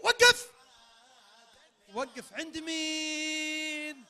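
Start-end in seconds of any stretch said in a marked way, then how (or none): 0.0-0.5 loud sharp call to halt; shouted stop. Real, not acted. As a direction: yell stop to freeze the game. Host shouts stop in game.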